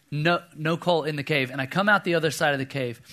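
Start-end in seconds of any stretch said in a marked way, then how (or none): none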